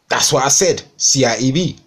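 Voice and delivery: protesting Cockney "C I E V", T left out; Cockney dropped T. Retold or imitated. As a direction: insist on "C I E V", swallowing the T. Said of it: "I don't actually pronunciate the T".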